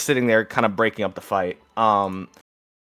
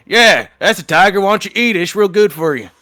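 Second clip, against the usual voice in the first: high, loud sarcastic sneer; loud jeering thug; mocking tough; 8 semitones higher, 9 dB louder than usual. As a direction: taunt him loudly with fake friendliness. Gambler taunts, says eat rat.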